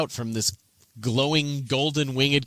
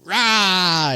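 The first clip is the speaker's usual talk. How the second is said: mock "Rah!" snarl, higher and louder; imitated snarl; 7 semitones higher, 6 dB louder than usual. Game master imitates player's intimidation of goblins, mock snarl. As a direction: echo the player's war cry. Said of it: "So you go".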